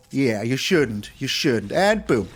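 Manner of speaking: normal talking voice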